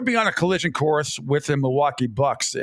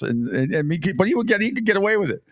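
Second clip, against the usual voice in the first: usual speaking voice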